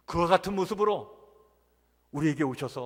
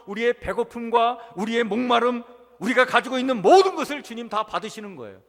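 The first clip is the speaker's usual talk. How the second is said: insistent, raised pulpit cadence; emphatic preaching; 4 semitones higher, 7 dB louder than usual. Acted. Preacher listing all the Lord receives from us, insistent and emphatic. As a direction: drive through the list item by item.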